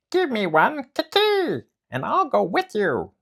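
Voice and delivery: very high, loud monkey chatter. Acted. Monkey drops from the tree to ask Momotaro, high chatter.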